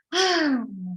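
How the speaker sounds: audible releasing exhale; cleansing sigh. Shown as a demonstration. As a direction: breathe it all out in a sigh. Guided breath out on the call.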